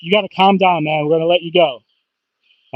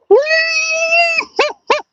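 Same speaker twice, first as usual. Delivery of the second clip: delighted high laugh. Real, not acted. Angler laughing as he lands the fish, delighted. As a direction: burst of thrilled laughter.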